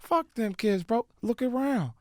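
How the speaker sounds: very high, soft rapper impression; slow, high put-on drawl. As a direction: mimic a friend in a small high voice. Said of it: "then [name]'s like".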